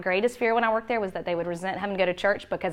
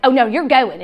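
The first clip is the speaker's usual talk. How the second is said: louder, higher parental order; mad insistence; 4 semitones higher, 9 dB louder than usual. Retold or imitated. Mom overruling her reluctant teen, mad insistence. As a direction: put your foot down, retelling an argument. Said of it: "I'm getting so mad about it".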